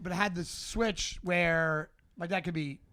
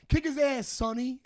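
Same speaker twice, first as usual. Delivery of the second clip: higher-pitched imitation of a sidekick; egging-on friend; 7 semitones higher than usual. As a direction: play the buddy urging on a fight. Friend eggs on fight in story.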